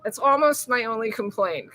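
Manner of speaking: normal talking voice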